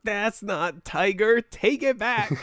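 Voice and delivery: mock outrage. Mock outrage over nickname.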